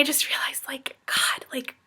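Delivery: breathless whispered realization. Real, not acted. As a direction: let it slip out in a whisper, overwhelmed. Emotional realization at buffet.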